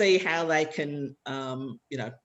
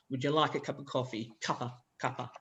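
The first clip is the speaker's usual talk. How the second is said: softer, unstressed syllables picked out; "Cuppa, cuppa" with a weak final schwa; marking the schwas; 4 semitones lower, 7 dB quieter, faster than usual. Shown as a demonstration. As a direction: say "Would you like a cup of coffee?" with the schwas reduced. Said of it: "where are the schwas".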